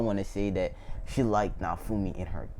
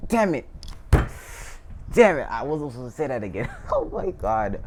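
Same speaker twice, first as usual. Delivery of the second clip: quick, higher groan at his own slip; exasperated at himself; 4 semitones higher than usual. Real, not acted. Storyteller slipping again, quick exasperated self-scolding. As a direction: scold yourself fast, half laughing.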